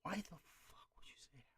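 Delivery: voiceless whisper of disbelief; hushed, exasperated question. Real, not acted. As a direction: whisper it, barely any sound. Exasperated reaction, whispered then voiced.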